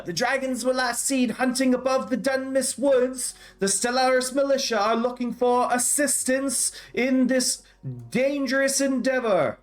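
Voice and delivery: high-pitched proclamation to the crowd; town crier calling out. Acted. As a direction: cry it out to the whole square like a town crier. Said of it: "the town crier also yells out".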